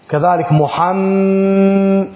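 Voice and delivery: "محم" with the doubled mim drawn out; held mim. Shown as a demonstration. Teacher showing the elongated mim. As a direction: hold the doubled mim of "محمد". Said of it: "لأن نون مشدد، ميم مشدد".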